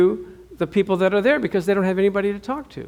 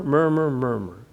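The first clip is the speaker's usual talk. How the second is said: mumbled murmuring; 4 semitones lower, 4 dB quieter than usual. Shown as a demonstration. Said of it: "Why don't you say that to it with me?".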